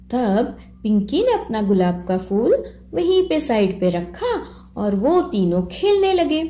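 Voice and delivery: plain reading voice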